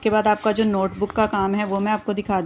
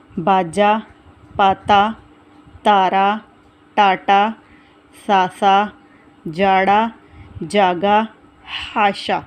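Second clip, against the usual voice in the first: put-on slow, clear word-by-word reading for children; reading words aloud; much slower than usual. Shown as a demonstration. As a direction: read each word out deliberately. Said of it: "पढ़िए में ... पहले पढ़ना है". (बच्चों को शब्द पढ़कर सुनाना)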